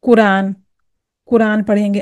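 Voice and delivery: कुरान with a plain Hindi k; common mispronunciation. Shown as a demonstration. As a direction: say कुरान the everyday way, no throaty Qaf. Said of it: "तो वो क्या पढ़ेंगे?".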